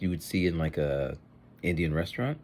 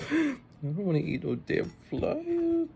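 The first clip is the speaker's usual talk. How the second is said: disgusted; slower than usual. Real, not acted. Gasp, disgusted at fly.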